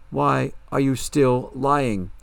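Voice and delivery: loud, very slow accusation; slow, forceful confrontation; accusing question. Acted. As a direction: press each word home as you accuse. Bianca confronts Darla about lying.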